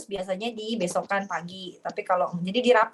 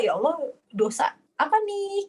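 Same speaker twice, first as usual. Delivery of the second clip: distressed lament; 8 semitones higher than usual. Retold or imitated. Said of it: "Jadi bunda tuh merasa".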